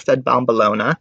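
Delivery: ordinary delivery, nothing marked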